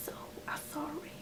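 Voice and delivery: sheepish whispered sorry; whispered apology. Real, not acted. Vlogger apologizing to viewers for a messy video, whispering.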